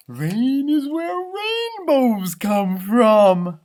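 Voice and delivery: high, slow and sunny puppy; cheerful puppy voice. Acted. Puppy Lucky chirping to Mackenzie about rain. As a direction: bubble over like a happy little dog. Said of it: "Lucky said".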